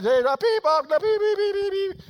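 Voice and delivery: high, loud comic beeps; rapid-fire mock electronic beeps; imitated beeping. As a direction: mimic a delivery scanner beeping away. Mimics delivery guys' beeping.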